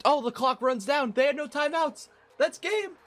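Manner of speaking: high call of the win; real thrill as time expires; high, triumphant call; excited call. Real, not acted. Clock runs out, calling the win.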